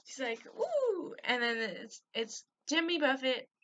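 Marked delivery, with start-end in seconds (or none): 0.6-1.1 squeaky high ooh; high surprised ooh. Retold or imitated. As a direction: gasp it out high and amazed. Character reacts in retold video.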